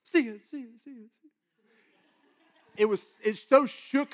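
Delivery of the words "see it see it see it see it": echoing voice. Shown as a demonstration. Echoing dream voice demo.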